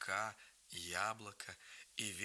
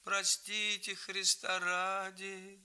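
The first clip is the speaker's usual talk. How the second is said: high, very slow whining plea; boy whining for mercy in a beggar's voice; like an old beggar; 7 semitones higher, slower than usual. A narrator's reading. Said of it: "не своим голосом, точно старенький нищий, тянул".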